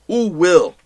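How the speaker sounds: ordinary delivery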